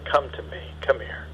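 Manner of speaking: put-on soft, gentle call to a hurt child; tender comfort. Parent kneeling to comfort crying child, soft and tender. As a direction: coax softly, arms open. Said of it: "get down on their knees ... hold out their arms and say".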